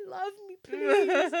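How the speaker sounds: high, slow, begging little voice; high pleading plea, stretched out slow; pleading inner voice. Retold or imitated. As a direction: whimper it out like a lovesick kid. Inner voice of cocky boy.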